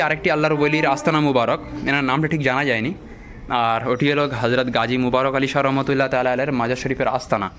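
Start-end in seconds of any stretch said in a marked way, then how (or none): none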